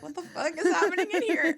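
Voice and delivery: high, loud, laughing disbelief; hammed-up confusion; mock bafflement. Voicing baffled people walking in.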